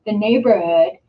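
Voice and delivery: ordinary talk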